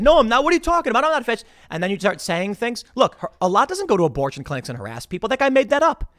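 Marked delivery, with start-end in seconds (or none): 0.0-1.5 high, loud flustered denial; mock defensive denial. The accused guy protesting, high and flustered. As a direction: protest like you're cornered. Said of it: "got on the defensive and said".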